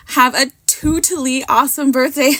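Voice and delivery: reading the words through a laugh. Real, not acted. Crafter reading her card's sentiment aloud, laughing. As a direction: let the laugh spill into the line.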